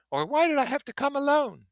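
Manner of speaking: very high, loud, gushing socialite; Daisy's rippling voice. Acted. Daisy gushing to her cousin from the car, very high and bright. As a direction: sing it out like a delighted society girl.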